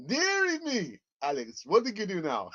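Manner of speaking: high exclaiming Dominican mum voice; Dominican accent. Retold or imitated. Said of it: "in a Dominican accent".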